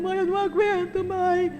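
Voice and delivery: a daughter who can't hold it in; voiced daughter breaking down; tearful daughter. Daughter crying at hospital.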